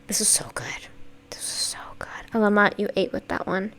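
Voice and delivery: hushed awed whisper. Real, not acted. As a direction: whisper the praise. Awed praise.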